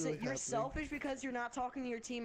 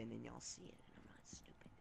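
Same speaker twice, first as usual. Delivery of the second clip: whispered aside; 12 semitones lower, 16 dB quieter than usual. Real, not acted. Muttered aside while gaming.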